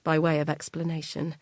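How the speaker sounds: plain reading voice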